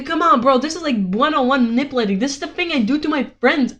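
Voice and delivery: usual speaking voice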